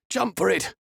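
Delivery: high, urgent shout. A narrator's reading. Peter shouting to Safiri as they near the dock end, urgent. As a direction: urge her on to jump. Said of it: "Peter shouted".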